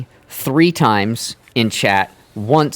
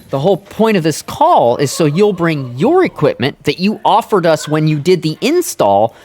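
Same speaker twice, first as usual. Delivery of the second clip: heated rant; 7 semitones higher, 4 dB louder than usual. Retold or imitated. Ranting at the agent.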